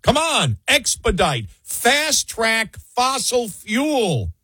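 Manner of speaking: high-pitched parody of desperate begging; hammed-up urgent demand, high but not shouted; mock screaming plea. Host plays states begging the administration, mock screaming. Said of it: "they're screaming".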